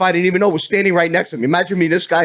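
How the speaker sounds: normal talking voice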